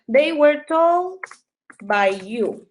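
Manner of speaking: very slow, each word spaced; slow dictation. Shown as a demonstration. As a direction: drag it out for students to copy. (dictado lento palabra por palabra)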